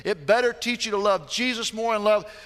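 loud, high preaching at full force; fervent pulpit preaching. Acted. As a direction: preach it out to the congregation.

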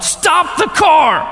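high, loud re-enacted shout; shouted command to halt; loud yell. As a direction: yell it out urgently, drawn out. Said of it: "I just yelled out loud".

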